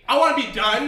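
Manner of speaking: high, loud mock whine; mock plea. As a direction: whine it like a begging kid. Begging mock plea, high pitch.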